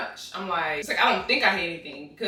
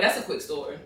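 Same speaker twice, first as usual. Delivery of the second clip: whispered; 5 semitones lower, 6 dB quieter than usual. Retold or imitated. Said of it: "And y'all probably like".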